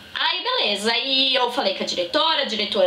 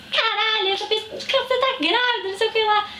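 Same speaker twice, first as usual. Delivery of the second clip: imitated yell; 11 semitones higher than usual. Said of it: "começou a gritar".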